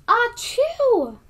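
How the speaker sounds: big high theatrical Achoo; loud sneeze. Acted. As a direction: sneeze it out huge. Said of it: "when I sneezed".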